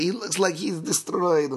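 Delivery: high, slow put-on commentator voice; commentator quote. Podcaster quoting the commentator's strange phrasing, put-on voice. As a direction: draw it out like the odd commentator. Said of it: "He's like".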